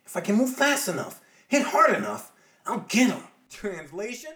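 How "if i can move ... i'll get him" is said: high, loud and determined; psyched-up hero, fist clenched; Spider-Man voice. Acted. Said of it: "who right fist clenched thinks".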